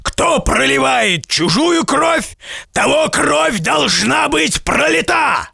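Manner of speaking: high, slow shouted decree; stern giant verdict. A narrator's reading. Giant sentencing the merchant to death, slow shouted decree. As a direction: pronounce the law of blood.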